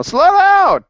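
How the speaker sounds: high, loud demo shout of slow down; yell. Shown as a demonstration. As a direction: yell it out like a student begging the teacher. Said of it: "just yell".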